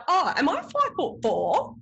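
high, slow dismayed question; mock dismay. Wife realizing her real height, high mock dismay. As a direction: let it sink in, mock dismay.

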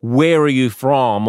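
blunt, loud, drawn-out demand; slow, mock-rude interrogation; rude questioning. Hypothetical rude question to a taxi driver, rude. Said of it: "so rude as to be like".

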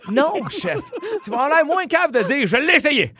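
high, excited teasing. Real, not acted.

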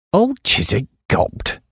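usual speaking voice

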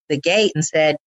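normal talking voice

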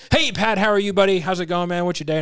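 excited greeting. Shown as a demonstration. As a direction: greet him like a long-lost buddy. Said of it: "So if I go".

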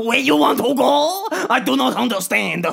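hammed-up Frenchman with a laugh; French accent. Co-host plays the French ticket seller, mock French accent. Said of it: "in his French accent".

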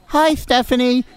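put-on high toddler voice; baby voice. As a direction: coax it like a small child. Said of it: "the sweet little baby voice".

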